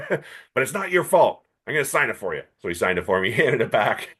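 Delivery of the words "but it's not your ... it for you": put-on softening old man. Friedman softens to kid.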